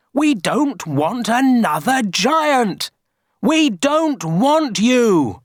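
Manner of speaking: high, slow, stretched-out crowd yell. A narrator's reading. Villagers rejecting a second giant, yelling. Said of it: "they yelled".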